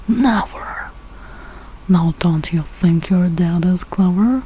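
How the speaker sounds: plain reading voice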